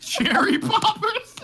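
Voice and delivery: high, stretched, laughing disbelief; incredulous. Real, not acted. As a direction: squeal it in disbelief. Incredulous at silly game name.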